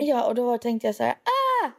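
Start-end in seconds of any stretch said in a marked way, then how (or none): 1.1-1.7 mock high-pitched shriek; retold startled cry. Telling how she found a lizard in her room, mock startled cry. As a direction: yelp as if startled.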